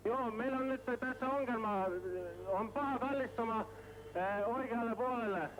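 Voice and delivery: viel höher, gehetzt, in Not; Seefunk. Real, not acted. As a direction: panischer Notruf über Funk. Notruf vom sinkenden Schiff. (angespannter Funkspruch in höchster Not)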